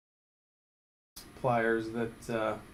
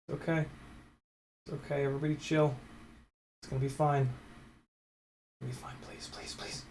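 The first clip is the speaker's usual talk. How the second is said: tense hushed concentration; 8 dB quieter than usual. Real, not acted. Calming himself mid-task.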